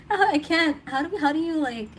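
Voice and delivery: ordinary talk, nothing marked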